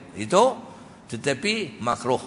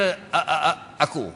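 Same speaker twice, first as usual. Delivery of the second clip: stammering demo. Shown as a demonstration. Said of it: "gagap ni".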